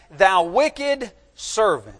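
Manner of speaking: slow, loud scriptural condemnation; stern rebuke. Acted. Master calling in the servant who showed no mercy, stern rebuke. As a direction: pronounce it slowly and forcefully, as a rebuke.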